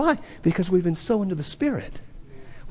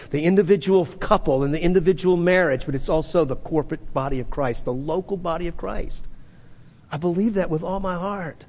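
ordinary talk, nothing marked

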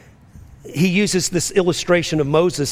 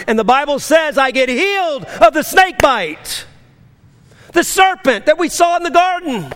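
preaching climb, higher and louder, fervent; full-force preaching; 7 semitones higher, 4 dB louder than usual. Acted. Preacher rousing the congregation about the cross, full force. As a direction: build it up like an altar call.

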